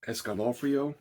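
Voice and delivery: Escalofrio said lightly in a put-on Italian accent; mock Italian accent. Shown as a demonstration. Reviewer reading the film's Spanish title, in a light mock Italian accent. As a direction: give the Spanish title your best attempt at an Italian accent. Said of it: "I'm trying to use my best Italian, uh, accent there".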